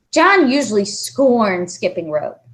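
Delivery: ordinary delivery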